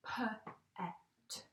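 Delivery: segmenting p-et into sounds; sounded out. Shown as a demonstration. Teacher sounding out a word for her class. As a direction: stretch p-et apart, sound by sound. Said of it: "It says".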